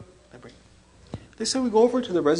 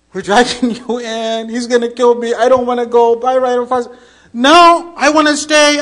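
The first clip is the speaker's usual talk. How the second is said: loud, high, hammed-up protest; mimicked panic; 7 semitones higher, 15 dB louder than usual. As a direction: reenact someone frantically resisting. Mimics man's earlier frantic protests.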